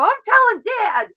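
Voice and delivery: little sister threatening her brother with Dad; childish tattling. Retold or imitated. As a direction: whine it like a bratty kid sister.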